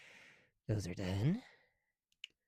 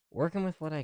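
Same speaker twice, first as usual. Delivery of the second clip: too loud; 5 semitones higher, 9 dB louder than usual. Real, not acted. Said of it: "I need to stop yelling".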